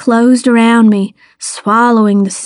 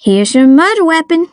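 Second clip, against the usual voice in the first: brisk, raised-pitch handover; professional; 6 semitones higher, faster than usual. A narrator's reading. Child handing the detective an oar, briskly professional. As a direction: present it in a businesslike way. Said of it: "keeping my voice professional".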